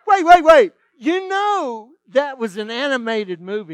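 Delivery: loud, high-pitched comic protest; urgent interruption. Retold or imitated. Substitute stops excited girls to check, loudly incredulous. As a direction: cut in loudly, then ease off slightly.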